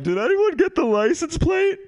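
very high, squeaky plea; mock tearful victim. Hit cyclist asks bystanders for help, mock pitiful. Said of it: "I'd be all like".

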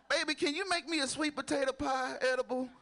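put-on high sweet old grandma asking; lightly voiced grandma. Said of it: "Ain't that some grandma shit".

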